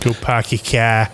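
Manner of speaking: slow, mock Boston accent. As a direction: drop the r's like a hammed-up Bostonian.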